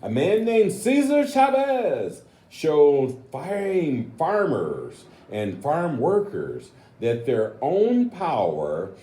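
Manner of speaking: storybook read-aloud, slow and drawn out; reading to children. Acted. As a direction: read the next page to the kids, savouring it. Reads picture book aloud.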